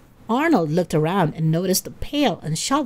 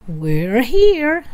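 louder, higher announcement; mild bellow; 5 semitones higher, 6 dB louder than usual. A narrator's reading. Bob Pataki announcing arrival at the beach house, mild bellow. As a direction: announce it big, a touch of a bellow. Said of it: "Mister Bob Pataki bellowed".

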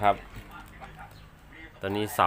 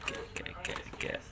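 whispering; 17 dB quieter than usual. Real, not acted. Muttering to himself while unboxing.

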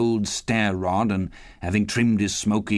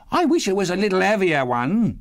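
brazen woman; 10 semitones higher, 3 dB louder than usual. A narrator's reading. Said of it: "replied the woman".

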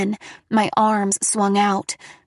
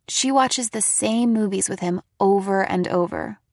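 plain reading voice

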